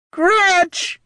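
very high shrieking cage beast; creature shriek. Acted. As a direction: shriek it like a caged demon. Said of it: "shrieked one of the cages".

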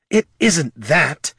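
slow, drawn-out angry hiss. A narrator's reading. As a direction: hiss each word through tight teeth. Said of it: "she hissed".

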